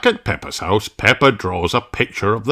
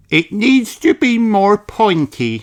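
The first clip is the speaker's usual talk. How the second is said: put-on higher child voice; Susie Sheep; 12 semitones higher than usual. Susie answering Mummy Sheep, higher child voice. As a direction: answer brightly like a small girl. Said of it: "says Susie".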